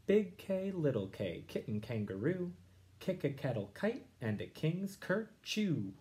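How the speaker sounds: lively read-aloud rhyme; reading to children. Acted. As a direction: read it out bouncy, for the class. Teacher reads Seuss aloud.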